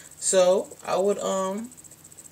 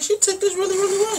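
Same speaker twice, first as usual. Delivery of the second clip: much higher, amazed and relieved; surprised disbelief; 13 semitones higher, 4 dB louder, faster than usual. Retold or imitated. Telling listeners how her coming out went, with high amazed relief. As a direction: squeak it out in disbelief.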